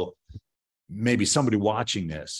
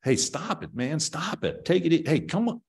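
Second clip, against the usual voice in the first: imitating a man begging higher and higher, stop it; pleading; 8 semitones higher than usual. Man overwhelmed by a gang pleading, climbing pitch. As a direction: voice climbing as he begs them to stop. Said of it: "he's pleading".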